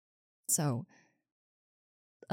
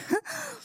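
squeaky giggle; 5 dB quieter than usual. Acted. Flustered joy.

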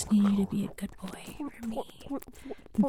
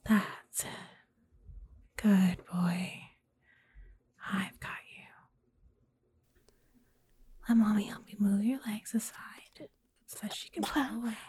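soothing whisper. Acted. Aftercare soothing after intense scene.

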